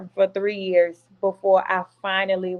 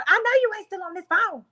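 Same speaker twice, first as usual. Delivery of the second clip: high-pitched mock mom on the line; much higher retold telling-off; imitated scolding mama; 11 semitones higher, faster than usual. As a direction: cut in like an indignant mama. Mama cutting into the call, retold.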